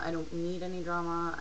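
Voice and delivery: ordinary talk, nothing marked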